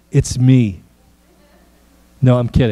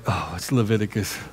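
dreading groan; 6 semitones lower, 11 dB quieter than usual. Acted. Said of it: "you're like".

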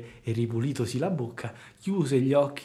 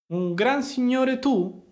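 mocking fox; 11 semitones higher, slower than usual. A narrator's reading. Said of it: "cominciò a ridere di un riso sguaiato e canzonatore".

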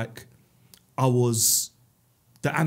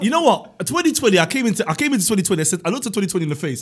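hyped up; 6 semitones higher, 6 dB louder than usual. Real, not acted. Hyped story opening.

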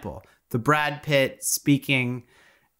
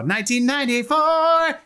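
mock fanfare; 11 semitones higher than usual. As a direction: stretch the year out grandly. Repeats year as mock announcement.

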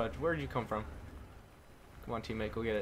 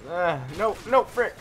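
rising higher and louder into a panicked yell; frantic shouting; 9 semitones higher, 10 dB louder than usual. Real, not acted. Gamer in a close fight urging his teammate, frantic yell. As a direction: escalate from alarm to a full shout.

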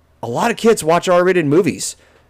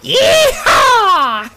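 high whooping yeehaw; loud cowboy whoop; 3 dB louder than usual. Acted. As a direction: holler it like a cowboy. Cowboy whoop about shotguns.

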